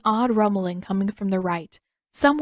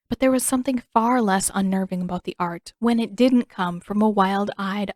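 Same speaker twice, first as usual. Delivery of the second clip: ordinary delivery, nothing marked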